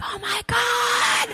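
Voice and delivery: high, very slow, stretched Oh my God; mock awe. Comic over chips and wine, acting out her giddy disbelief. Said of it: "I'm like".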